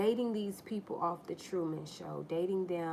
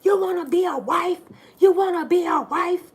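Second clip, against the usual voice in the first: put-on high, loud screaming boyfriend; furious mock yell; 11 semitones higher, 15 dB louder than usual. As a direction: explode at her in a shrill rage. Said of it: "And he's like".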